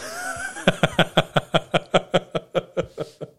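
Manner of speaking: a long, high, falling laugh. Real, not acted. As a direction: let a moved laugh slip out. Happy laugh after proud memory.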